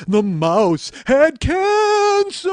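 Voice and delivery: high, drawn-out spooky wailing; loud slow ghost voice trailing down; ghostly wail. Pretend. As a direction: stretch it into a long ghostly howl. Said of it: "ghostly flange".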